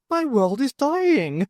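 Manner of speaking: high, slow, hushed alien voice; a dying world's grief, breathed high; lightly voiced alien in despair; alien whispering. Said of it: "he whispered".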